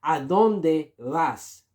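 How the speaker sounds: ¿A dónde vas? said very slowly and clearly; slow model phrase. Shown as a demonstration. Teacher slowly models ¿A dónde vas? for learners. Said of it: "You have to say".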